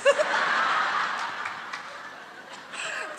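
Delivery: real high burst of laughing; long laugh at own performance; laughter. Real, not acted. Laughs after her song.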